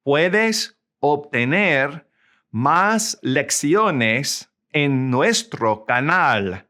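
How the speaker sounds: slow Spanish, phrase by phrase; slow model sentence. Shown as a demonstration. Spanish teacher models the sentence for learners, slow and deliberate. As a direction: say it slowly with pauses for learners to follow.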